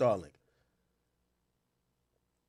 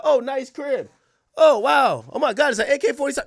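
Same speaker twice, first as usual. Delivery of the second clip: mock impressed tech; 8 semitones higher, 4 dB louder than usual. Tech admiring the apartment.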